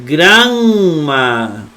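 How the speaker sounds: granma with a long, held nasal before the ma; wrong doubled consonant. Shown as a demonstration. Teacher warning students off lengthened double letters, granma drawn out. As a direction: drag out the middle of granma. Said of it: "No se pronuncia".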